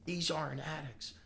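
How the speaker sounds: retold disbelief. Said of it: "And I'm like".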